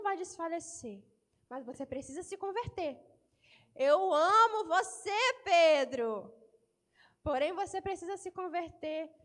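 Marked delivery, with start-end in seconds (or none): 3.7-6.1 loud, slow, tender Jesus. Acted. Preacher voicing Jesus telling Peter, loud and slow.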